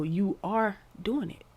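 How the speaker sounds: normal talking voice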